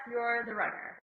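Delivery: ordinary talk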